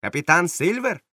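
captain mocking the pirate title; scornful captain. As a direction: scoff at the title.

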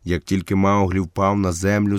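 usual speaking voice

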